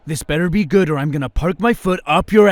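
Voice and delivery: loud, fast, high threat; angry threat. Acted. Fed-up partner threatening his stalling friend, loud and fast. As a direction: lose patience and threaten him.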